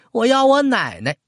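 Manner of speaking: put-on high-pitched little boy's plea; whining child. A narrator's reading. Grandson demands his grandmother, high childish whine. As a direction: wail for it like a small child.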